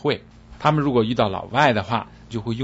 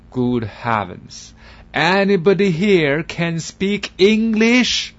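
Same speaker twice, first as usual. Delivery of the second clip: slow, rising, louder foreign voice; exasperated loud plea; 8 semitones higher, 6 dB louder, much slower than usual. Acted. Foreigner loudly begging for an English speaker. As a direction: beg the room, fed up. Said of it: "声音有点大".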